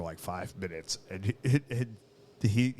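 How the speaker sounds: ordinary delivery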